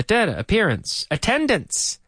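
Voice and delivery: ordinary talk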